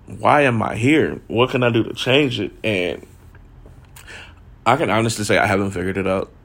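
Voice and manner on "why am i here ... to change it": ordinary talk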